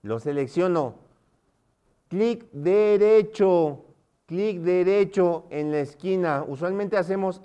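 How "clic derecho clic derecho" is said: "Clic derecho" very slow, high and emphatic; emphatic instruction. Shown as a demonstration. Teacher giving students the key step, emphatic instruction. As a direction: stretch "Clic derecho" so everyone follows.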